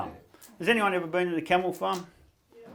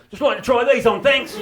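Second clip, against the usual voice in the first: high, loud, laughing ask; cheeky loud request; 7 semitones higher, 5 dB louder than usual. Retold or imitated. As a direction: announce it to the shop assistant. Asking to try on condoms.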